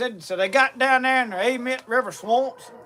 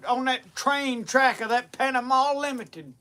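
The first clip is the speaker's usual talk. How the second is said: usual speaking voice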